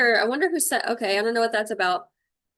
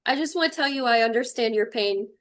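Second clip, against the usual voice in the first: normal talking voice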